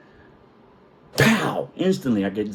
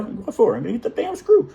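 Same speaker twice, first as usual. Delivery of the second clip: high quoted voice; 10 semitones higher than usual. Retold or imitated. Retold exchange at work.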